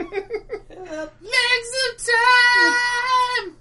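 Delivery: laughing shout. Acted. Dramatic riff while laughing.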